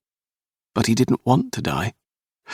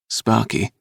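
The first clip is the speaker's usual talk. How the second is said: ordinary delivery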